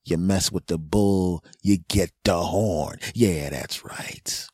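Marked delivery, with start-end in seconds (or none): 3.2-4.5 slow whispered "yeah, that's right"; comic gloating whisper to audience; smug whisper. Real, not acted. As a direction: breathe it out smugly.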